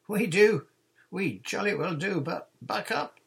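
high, loud, impatient yell; shouted back. A narrator's reading. Nancy shouting down to the boat, loud and impatient. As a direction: holler back, eager and impatient. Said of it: "Nancy Blackett shouted back".

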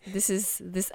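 ordinary delivery

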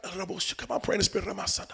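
whispered, hurried praying under the breath; muttered prayer. Retold or imitated. Driver praying in the car, whispered prayer. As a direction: imitate someone praying very softly.

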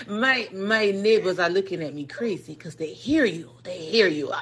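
normal talking voice

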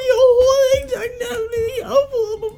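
high whiny fake sob; fake-sob the complaint up high; mock crying. [name] whining that friends interrupt him, high fake sob. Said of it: "nu begynder [name] at græde".

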